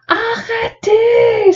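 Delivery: high, drawn-out cry of protest; a child's shrill stop, held long. A narrator's reading. Le cri de Catherine, la petite fille qui refuse d'être achetée, aigu et long. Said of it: "s'écrie Catherine".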